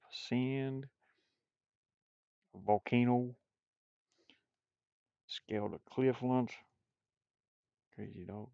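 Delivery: ordinary talk, nothing marked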